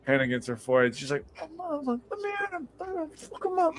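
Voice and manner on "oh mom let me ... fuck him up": tiny, soft high squeak of fury; mock rage in a very high little voice; angry girlfriend. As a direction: imitate a small raging girlfriend, squeaky. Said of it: "She'd get angry at me".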